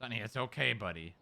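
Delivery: soft, low comfort for the dog; gently calm a scared pet; soothing. Real, not acted. Calming the upset dog.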